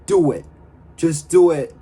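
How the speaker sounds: urgent mock yell; retold yell. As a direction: yell it at him in desperation. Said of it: "she yells to Milchek".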